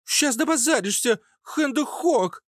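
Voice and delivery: put-on very high, sharp childish voice; squeaky child threat. Hidden child gunner threatens the strangers, sharp and squeaky. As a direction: threaten in a piercing little squeak. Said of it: "резкий писклявый голос, скорее всего, детский".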